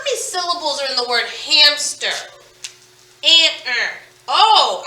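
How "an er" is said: "An-er" as two vowel chunks, consonants left off; isolated vowel sounds. Shown as a demonstration. Teacher helping pupils count syllables in hamster, isolated vowel sounds. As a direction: sound out just "An" and "er", separately. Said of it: "I heard two vowel sounds".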